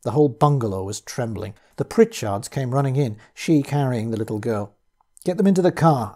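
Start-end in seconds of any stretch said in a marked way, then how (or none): 5.2-6.2 louder, raised command; shouted. Acted. Narrator ordering Pritchard to load the car, shouting. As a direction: shout the order over the noise. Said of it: "I shouted".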